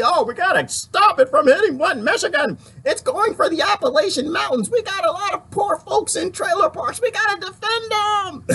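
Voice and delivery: high, hammed-up voice of officials pretending to care; mock official. Mocking officials who claim they'll save Flint, hammed-up high.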